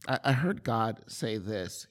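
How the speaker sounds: ordinary delivery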